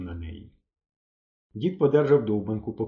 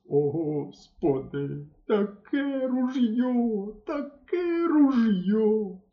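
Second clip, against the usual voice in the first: very slow, higher moaning cry; moaning lament; 9 semitones higher, much slower than usual. A narrator's reading. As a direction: groan over the lost gun. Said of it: "Пливе і стогне". (мисливець стогне, пливучи до берега)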